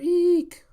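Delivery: loud, very high drawn-out shriek; squeal. Acted. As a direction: let out an excited fan squeal. Crowd squeals as courtesan arrives.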